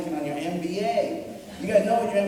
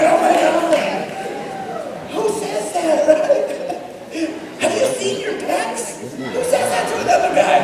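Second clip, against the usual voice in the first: high, laughing star-struck gush; high giddy fanboy squeal; freaking out; 13 semitones higher, 3 dB louder than usual. Retold or imitated. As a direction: gush giddily at a celebrity. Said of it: "was like".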